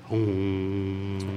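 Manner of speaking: low drawn-out hum; mock sage humming; knowing sponsor hum. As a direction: hum it like a wise old sponsor. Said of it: "some of these sponsors are like".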